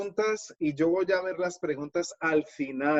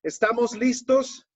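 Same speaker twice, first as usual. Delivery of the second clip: raised pitch, rousing classroom call; teacher rallying class; 7 semitones higher, 4 dB louder than usual. Shown as a demonstration. Teacher asking his students if they are ready, rallying. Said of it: "Yo siempre le digo a mis estudiantes".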